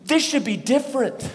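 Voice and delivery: high-pitched cry of protest; pained protest. Acted. Pastor voices our shared cry amid brokenness, pained protest. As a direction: plead that things are wrong. Voicing humanity's protest to God.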